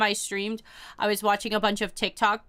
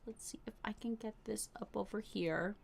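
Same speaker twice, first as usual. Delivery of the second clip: whispered to herself; under the breath, voiceless; whispering; 17 dB quieter than usual. Real, not acted. Muttering to self setting up.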